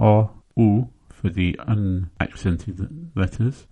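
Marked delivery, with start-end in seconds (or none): 0.0-0.9 O and U sounded out slowly; Volapük vowels. Shown as a demonstration. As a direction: say O, U slowly and clearly. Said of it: "The sounds are".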